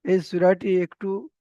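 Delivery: ordinary talk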